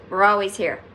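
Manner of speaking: ordinary talk, nothing marked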